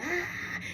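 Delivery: high, sudden mock shriek; hammed-up scream of someone falling out; imitated church scream. Mocking churchgoers who fall out, high mock scream. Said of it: "they screaming".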